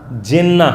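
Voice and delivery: جنہ said "jinna", with a short i; "i" vowel in جنہ. Shown as a demonstration. Teacher lists the three readings for students, جنہ as "jinna". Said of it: "اسی کو جنہ بھی پڑھا جا سکتا ہے".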